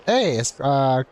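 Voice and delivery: normal talking voice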